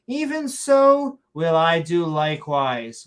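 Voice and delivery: slow, grand fake-Shakespearean line; mock Shakespearean accent. Reading the would-be follower's vow, mock Shakespearean accent. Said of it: "saying it in a Shakespearean accent".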